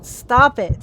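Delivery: loud real frustration; angry snap. Real, not acted. Gamer yelling at enemies to stop, angry. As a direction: bark it at the screen. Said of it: "apologize for getting really angry".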